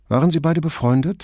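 casual, harmless question; innocently. A narrator's reading. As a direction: ask as if it means nothing. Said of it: "fragte Brunetti harmlos".

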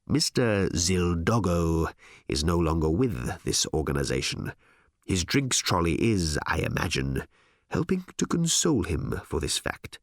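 unhurried executive, pausing for effect; smooth boss. Acted. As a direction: break the news with measured smugness. Harl answers smoothly.

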